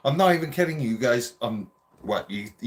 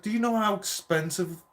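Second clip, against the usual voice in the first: angry dad; 7 semitones higher than usual. Retold or imitated. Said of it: "He's like".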